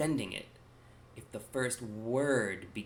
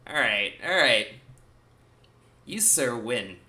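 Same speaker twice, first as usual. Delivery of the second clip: louder, delighted concession; conceding with delight; 10 dB louder, slower than usual. Retold or imitated. Reacting to a clever Peaky Blinders joke, louder amused concession. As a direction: grant the win like a good sport tipping his hat. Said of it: "and I was like".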